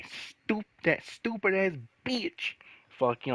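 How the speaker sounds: high, strained angry rant; angry outburst. Real, not acted. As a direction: spit out the insult in real anger. Angry rant about coworker.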